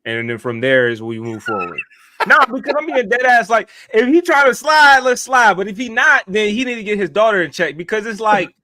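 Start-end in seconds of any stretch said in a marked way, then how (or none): 2.3-6.8 loud, high, fired-up; hyped-up shout. Real, not acted. Fired up and shouting about sliding on the dad. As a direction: shout it like you're ready to fight. Hyped threat to fight back.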